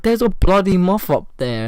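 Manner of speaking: high, loud mock alarm; retold screaming. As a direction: imitate a brother yelling in panic to his sister. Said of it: "What the fuck are you screaming about?".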